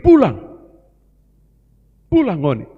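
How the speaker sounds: loud, firm order to go home; firm command. Acted. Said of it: "Tuhan suruh begini". (perintah tegas dan keras)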